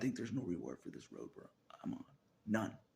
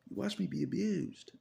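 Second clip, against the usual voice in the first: loud, high anguished cry; anguished outcry; 12 semitones higher, 8 dB louder than usual. Real, not acted. Accusing God of watching him suffer, anguished cry.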